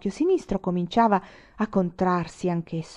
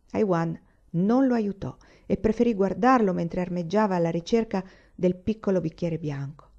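plain reading voice

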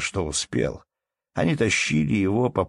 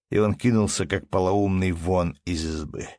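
ordinary delivery, nothing marked

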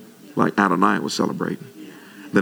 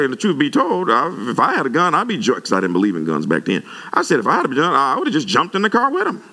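excited reply; 7 semitones higher than usual. Retold or imitated. Pastor retells his reply.

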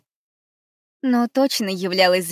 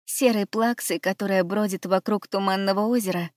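usual speaking voice

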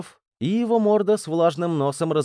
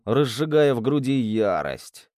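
plain reading voice

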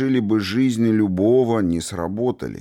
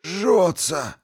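pained cry; 10 semitones higher than usual. A narrator's reading. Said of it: "Игнациус застонал".

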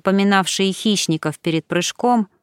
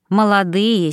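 usual speaking voice

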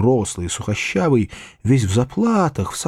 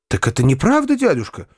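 raised, eager exclamation; almost delighted, higher-pitched questioning; near delight; 9 semitones higher than usual. A narrator's reading. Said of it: "вскричал я почти в восторге".

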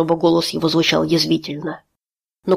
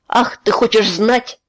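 slow, mocking and higher; bitter sarcasm; 4 semitones higher, slower than usual. A narrator's reading. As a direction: turns his words back at him. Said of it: "вернулась часть его бешенства".

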